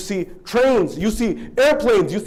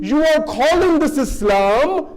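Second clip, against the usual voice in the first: higher, louder, slow indictment; preaching rebuke; 9 semitones higher, 4 dB louder, slower than usual. Acted. Imam Hussein rebukes the people, slow and loud. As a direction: hold them to account.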